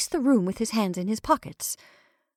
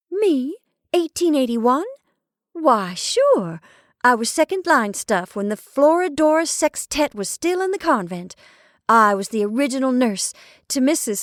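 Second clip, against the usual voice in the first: put-on higher, louder teasing young woman's voice; playful Marcia; 6 semitones higher, 6 dB louder than usual. A narrator's reading. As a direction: brag with mock pride, tongue in cheek. Marcia boasts playfully to Horace.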